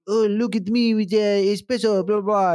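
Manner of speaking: high, smug French caricature; lightly mocking French voice; mock French person. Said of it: "French people are like".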